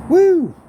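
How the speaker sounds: loud, high "woo"; short celebratory holler; excited whoop. Real, not acted. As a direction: let out a big high whoop. Whoops at his shot.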